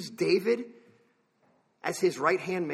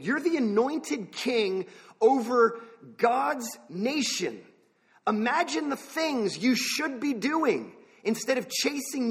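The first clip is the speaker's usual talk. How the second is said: raised pitch, imploring and bewildered; desperate pleading; 5 semitones higher than usual. Acted. David demanding to know why Saul keeps chasing him, desperate pleading. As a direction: plead for an answer, voice lifted high. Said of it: "David desperately wants to understand".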